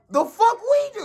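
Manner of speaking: squeaky high mock panic from an old judge; panicked judge. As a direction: shriek it like a frightened judge. Judges panicking during trial rampage.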